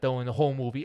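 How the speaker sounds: usual speaking voice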